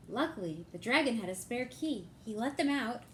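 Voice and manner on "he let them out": plain reading voice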